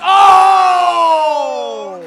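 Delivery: huge high shout of surprise; wrestling commentator. Real, not acted. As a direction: erupt at the big move. Big move, commentator erupts.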